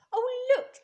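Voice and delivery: put-on boy spotting coconuts, thrilled; excited cry. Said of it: "cried Toto".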